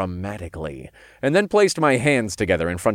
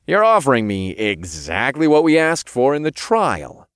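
higher, louder, pointed delivery; somewhat snarky; 6 semitones higher, 5 dB louder than usual. A narrator's reading. Hero points out the late offer, snarky. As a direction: needle them with it. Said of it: "somewhat snarkily".

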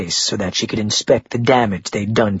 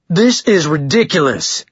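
raised, sharper angry protest; snarling; 5 semitones higher than usual. A narrator's reading. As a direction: snarl it out, indignant and higher. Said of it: "Blaine was snarling".

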